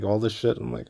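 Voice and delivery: ordinary talk, nothing marked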